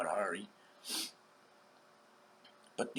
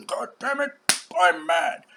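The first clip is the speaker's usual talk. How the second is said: higher and louder, mock furious; deceived man mad; 4 semitones higher, 12 dB louder than usual. Deceived man raging at the world, mock angry yell. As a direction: ham up a man fuming at being deceived. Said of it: "First he's mad".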